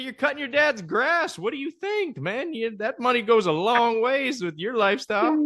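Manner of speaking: high-pitched mock-incredulous retort; retold exasperated needling; incredulous teasing. Retold teasing retort to his friend.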